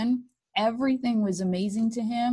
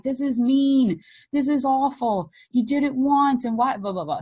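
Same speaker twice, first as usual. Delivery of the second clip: higher, louder indignant complaint that fades to blah blah; railing at the unfairness; upset protest; 5 semitones higher, 4 dB louder than usual. Retold or imitated. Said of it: "so upset and scared and mad".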